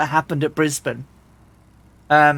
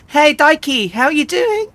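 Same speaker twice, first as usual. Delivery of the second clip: bright, high sing-song hello; cheery high greeting; 9 semitones higher than usual. Real, not acted. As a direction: sing out a welcome to a friend. Greeting a new chat viewer.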